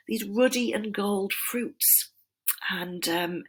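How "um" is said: normal talking voice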